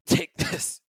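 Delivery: high breathy whisper, barely voiced; strained whisper. Dying Cheryl hands Roy the paper in a whisper. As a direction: push out the words with last strength.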